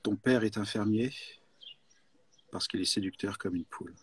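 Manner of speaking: low, soft seductive line. Acted. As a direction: purr it low, charming. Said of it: "tu dois être séducteur".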